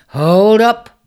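loud booming call. Acted. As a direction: stop him with a boom. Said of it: "boomed Taurus".

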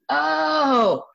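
big acted "oh" of sudden understanding; retold realization. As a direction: act out the penny dropping. Retells finally getting the message.

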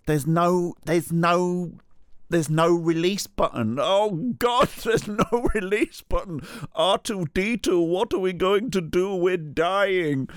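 high, hammed-up sci-fi panic; mock panic. Trapped man tells the woman there's no release button, mock panic. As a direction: wail it like a doomed film hero. Speaker voices his own panic.

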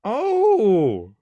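high, loud surprised gasp of a word. Real, not acted. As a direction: blurt it out in genuine surprise. Surprised by chicken chain fact.

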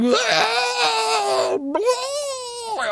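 a very high, strained mock scream; hammed-up Brock Lesnar scream; mock lunatic scream. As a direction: scream like your nuts are in a clamp. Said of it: "him screaming like a fucking lunatic".

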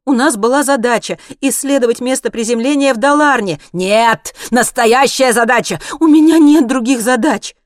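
loud, high frantic protest; desperate exclamation. A narrator's reading. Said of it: "в отчаянии воскликнула она". (отчаянно, почти крича)

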